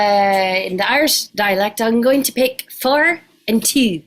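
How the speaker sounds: demonstrated Irish dialect; performed Irish lilt; Irish accent. Shown as a demonstration. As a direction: put on an Irish accent for the crowd. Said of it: "first I'll do Irish".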